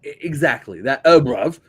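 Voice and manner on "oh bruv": mock bruv. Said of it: "isn't the way you should speak".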